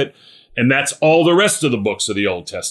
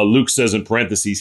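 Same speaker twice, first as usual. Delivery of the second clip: ordinary delivery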